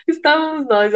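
laughing nervously through the words; giggly, nervous restart. Real, not acted. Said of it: "risada de nervoso". (rindo de nervoso)

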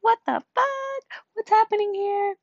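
squeaky-high "what is going on" panic, acted out; alarmed bewilderment. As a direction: exclaim it in a high put-on voice, baffled. Startled at sudden emotional release.

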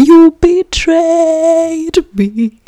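very high, slow stretched cry; hammed-up high-pitched lament; melodramatic wail. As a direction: wail it like a soap-opera heroine. Dramatic betrayal reenactment.

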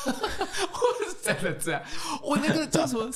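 high, slow words broken up by laughter; laughing. Real, not acted. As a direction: laugh through it, hardly getting words out. Laughing at being squeezed in. (边笑边说)

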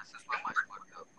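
breathy unvoiced whisper. Real, not acted. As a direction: whisper the aside. Whispered aside.

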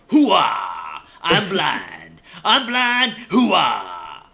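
loud barking army colonel yell; Al Pacino hoo-ah shout. Retold or imitated. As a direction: bellow it like a blind old colonel. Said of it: "All he does is go".

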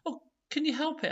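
put-on high, loud, fast excited girl. A narrator's reading. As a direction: burst out with delight. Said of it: "claps her hands together in excitement".

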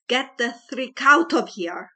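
very loud furious shout. A narrator's reading. Father shouting at the girl hiding behind his son, loud. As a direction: throw her out with a shout. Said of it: "Mister Riddle shouted".

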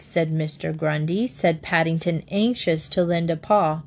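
ordinary delivery

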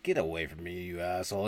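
low, quiet dismissal; acted-out curt police chief; gruff chief. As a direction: brush off the recruit under your voice. Chief rebuffs eager recruit.

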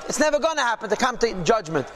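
ordinary talk, nothing marked

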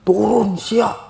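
high, loud commanding yell; possessed shout. Retold or imitated. Possessed climber ordering the hiking group down, shouted. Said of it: "dia teriak, dia ngeberontak".

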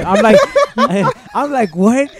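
high, loud retort broken by laughter; laughing incredulity. Retold or imitated. Student retelling the copying accusation, laughing. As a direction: crack up while quoting your disbelief.

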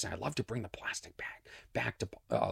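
hushed aside, no voice; whisper. Real, not acted. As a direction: whisper it like a secret. Private aside about the bag.

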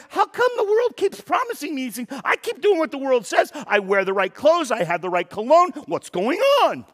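high, loud, whiny complaint; exasperated whine. Retold or imitated. Pastor mocks his own frustration with the world, high and whiny. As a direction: play the fed-up sucker who keeps getting cheated. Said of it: "I'm like".

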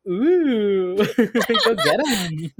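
high, loud, drawn-out mock ooh, laughing; slow singsong teasing with laughter in it; teasing Derek. Host imitates Derek egging on his friend's crush, gleefully teasing.

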